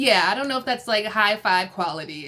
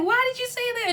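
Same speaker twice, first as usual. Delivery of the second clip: very high mock complaint; hammed-up upset sister; whiny question; faster than usual. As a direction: pitch it way up, imitating. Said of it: "She was like".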